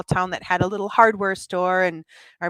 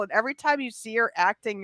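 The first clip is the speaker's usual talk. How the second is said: ordinary talk, nothing marked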